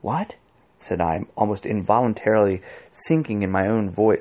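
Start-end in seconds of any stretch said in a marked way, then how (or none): 0.0-0.3 soft, higher-pitched hushed question; startled question under the breath; hushed, near whisper. A narrator's reading. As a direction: sink your voice almost to a whisper. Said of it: "sinking my own voice to a whisper".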